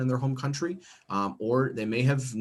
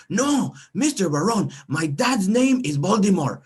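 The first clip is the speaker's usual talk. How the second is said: put-on high, louder child's voice; little boy voice; 9 semitones higher, 6 dB louder than usual. Student correcting his teacher, high insistent child voice. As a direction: insist like a stubborn kid. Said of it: "And I was like".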